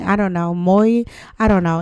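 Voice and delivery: ordinary talk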